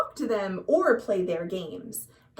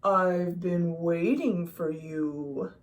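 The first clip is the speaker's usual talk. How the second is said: low, slow and looming; deep octopus voice; 6 semitones lower, slower than usual. A narrator's reading. Octopus greeting the rainbow fish, low and slow. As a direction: speak like an ancient wise octopus from a dark cave. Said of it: "said the octopus with a deep voice".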